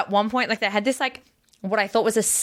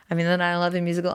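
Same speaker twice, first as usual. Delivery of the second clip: fast, flat disbelieving mutter; rushed disbelief; much faster than usual. Retold or imitated. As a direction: race through it in shock. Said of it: "sitting there being like".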